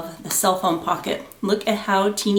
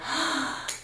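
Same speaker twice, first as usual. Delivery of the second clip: gasp of surprise; 8 dB quieter than usual. Real, not acted. Sees the package.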